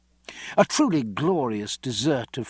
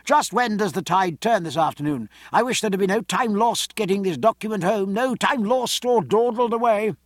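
higher-pitched call with a needling note; calling down the table; 7 semitones higher than usual. A narrator's reading. Fox to Aubrey across the dinner table, calling out. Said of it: "he called down the table".

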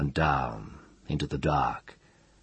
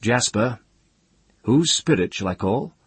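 raised, ringing call; clear voice; 7 semitones higher, 5 dB louder than usual. A narrator's reading. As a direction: call out clearly across the slope. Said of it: "said in a clear voice".

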